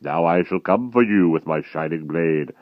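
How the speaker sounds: loud, heavy threat; menacing giant. A narrator's reading. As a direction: promise the hunt with relish.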